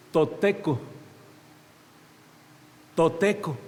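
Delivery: toteco dicho despacio, sílaba por sílaba, al estilo náhuatl; pronunciación náhuatl. Shown as a demonstration. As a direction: di toteco con calma, marcando cada sílaba. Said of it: "Dice que la palabra para Dios es". (palabra náhuatl toteco pronunciada con cuidado)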